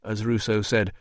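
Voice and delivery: plain reading voice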